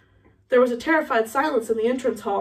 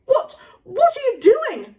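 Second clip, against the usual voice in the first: aghast, high and loud; slow, stammered disbelief, shooting up in pitch; shocked professor demanding an explanation; McGonagall; 12 semitones higher, 5 dB louder, slower than usual. Acted. McGonagall aghast at the bouncing ferret.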